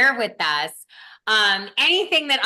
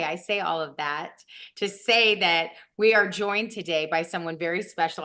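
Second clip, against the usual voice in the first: normal talking voice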